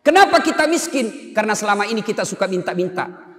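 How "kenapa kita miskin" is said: rhetorical call. Real, not acted. Preacher's rhetorical question.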